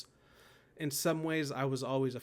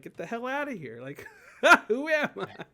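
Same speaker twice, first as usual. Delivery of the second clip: laughing mock retort; 10 semitones higher, 11 dB louder than usual. Poems in head answering back.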